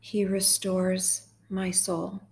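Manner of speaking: slow, measured psalm reading; guided meditation. Real, not acted. Host guiding sad listeners through Psalm twenty-three, slow meditation. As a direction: read each phrase slowly, leaving room to repeat it.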